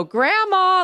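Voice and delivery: bright, high call into the house; excited high call of Grandma; sing-song call. Retold or imitated. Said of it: "like that".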